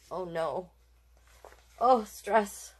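usual speaking voice

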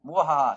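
mock evil laugh over a shortcut. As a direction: gloat like a cartoon villain. Gloating over a found shortcut.

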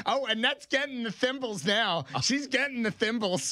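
high, huffy mom voice; imitating mom. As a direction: play the miffed mother, pitched high. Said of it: "My mom's like".

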